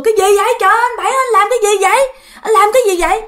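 startled family yelling at uncle Bảy to stop; hoảng hốt la lớn, giọng cao; alarmed shout. Retold or imitated.

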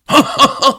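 high, hearty taunting guffaw; mocking drunken laugh; loud mocking laugh. A narrator's reading. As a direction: burst out laughing at him. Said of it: "keyifli bir kahkaha attı".

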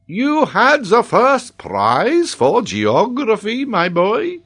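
loud, slow, high-pitched disbelief; amazed geographer. A narrator's reading. Geographer questions the boy about his prize, loudly amazed. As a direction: draw the question out in astonishment.

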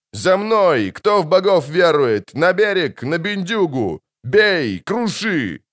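high, forceful rallying yells; battle cry. A narrator's reading. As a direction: shout the charge in separate bursts. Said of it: "орал Кагыр". (боевой клич, во весь голос)

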